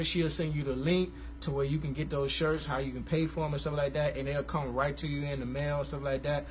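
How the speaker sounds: usual speaking voice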